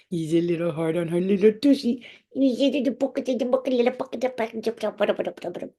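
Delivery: high babbling coo running into nonsense. Real, not acted. Owner fussing over dog's little tushy, high silly babble. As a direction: babble adoringly at a pet, pitched high. Babbling baby talk to dog.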